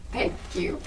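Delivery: odd whispered thanks. Real, not acted. Answering an imagined bless you, she whispers thank you. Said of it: "I don't know why I said it like that".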